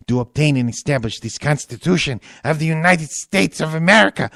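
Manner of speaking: a silly high Peter Lorre reading the preamble. Retold or imitated. Podcaster hams up the Constitution as Peter Lorre. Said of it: "as read by Peter Lorre".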